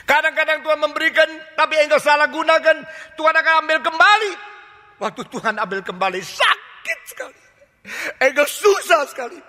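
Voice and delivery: preacher at full force; fiery preaching. Acted. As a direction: hammer the rebuke home to the congregation.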